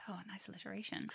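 soft breathy whisper; whispered aside. Real, not acted. Sly aside to co-host.

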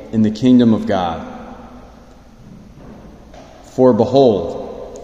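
ordinary delivery

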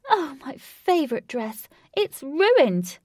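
high, loud princess wail; crying out. A narrator's reading. Muddied princess over her soiled dress, crying out. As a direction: wail it in dismay, rising on the last word. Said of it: "The princess cried".

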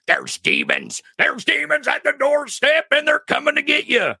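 high, frantic Alex Jones impression; mock doomsday conspiracy yell; Alex Jones rant. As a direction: rant about demons like a conspiracy radio host. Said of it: "Now I'm starting to sound like Alex Jones".

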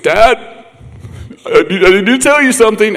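high-pitched mock crying voice; tearful teenage daughter. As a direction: imitate a daughter calling in tears. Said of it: "She called me crying".

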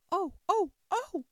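loud, high-pitched cries of pain; screaming. A narrator's reading. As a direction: shriek "oh" as the queen. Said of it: "the queen began screaming so loud".

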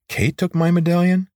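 high, incredulous question; shocked disbelief. Acted. Corvin learns Kate took his medallion, shocked.

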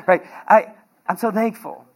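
ordinary delivery

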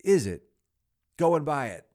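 very loud, high exclamation; loud, eager call to the boy; excited order. Acted. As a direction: fire it off as a delighted command.

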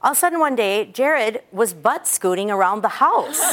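ordinary talk

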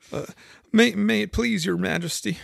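high, hesitant, deferential; very humble. A narrator's reading. Gardener answers his Queen, very humbly. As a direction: stammer meekly to royalty. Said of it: "in a very humble tone".

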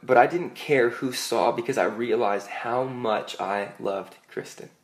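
ordinary talk, nothing marked

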